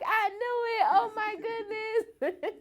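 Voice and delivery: very high squealed reaction; excited coworkers. Retold or imitated. Coworkers hearing she is pregnant, squealing excitedly. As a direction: imitate squealing coworkers, drawn-out ending.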